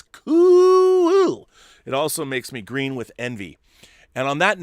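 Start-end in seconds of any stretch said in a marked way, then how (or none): 0.0-1.5 high, loud squeal of delight; excited squeal. Real, not acted. Praising a friend's collection video, excited high squeal. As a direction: squeal it like a thrilled fan.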